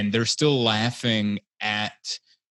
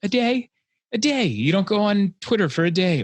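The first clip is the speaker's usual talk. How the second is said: excited; 9 semitones higher, 4 dB louder than usual. Real, not acted. Excited riff on short attention spans.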